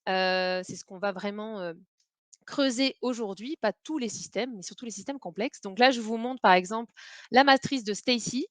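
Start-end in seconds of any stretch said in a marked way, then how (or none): none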